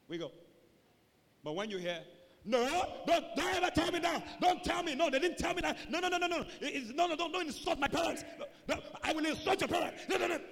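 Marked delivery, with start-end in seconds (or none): 2.4-10.4 high, loud heated protest; quarrelling. Acted. Someone defending parents in a quarrel, arguing loudly. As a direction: argue over the other person, indignant. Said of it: "There's a problem".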